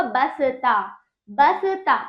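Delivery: बसता as three beats, ba-sa-ta; wrong full स. Shown as a demonstration. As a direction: put a vowel after स in बसता. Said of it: "ये गलत है".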